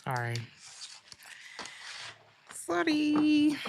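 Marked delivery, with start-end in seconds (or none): 2.5-3.6 singsong apology. Real, not acted.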